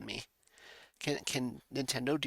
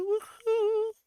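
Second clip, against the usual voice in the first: cheer; 8 dB louder than usual. Pretend. End of sung theme.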